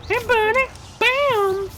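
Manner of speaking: high, loud sleazy cartoon greeting; Family Guy style voice. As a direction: pitch it way up like a cartoon creep. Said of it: "I've been doing that voice".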